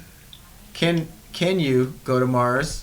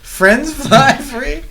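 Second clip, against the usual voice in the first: incredulous; 12 semitones higher, 9 dB louder, much slower than usual. Real, not acted. Incredulous echo of ad pitch.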